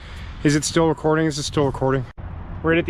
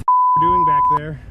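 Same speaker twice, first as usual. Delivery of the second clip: high, loud, slow shriek; panicked shriek; 4 dB louder, slower than usual. Real, not acted. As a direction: squeal in fear. Shaken after escape.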